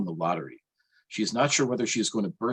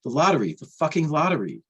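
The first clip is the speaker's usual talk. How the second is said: higher, worked-up repeating; agitated excitement; 7 semitones higher than usual. A narrator's reading. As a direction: spill it out, rattled. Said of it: "he says".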